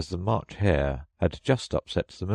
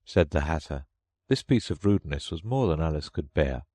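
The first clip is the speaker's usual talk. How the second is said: plain reading voice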